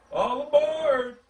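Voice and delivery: high, slow, loud call of All aboard; conductor's cry. Acted. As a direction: call the whole train to board like a conductor. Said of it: "the conductor cried out".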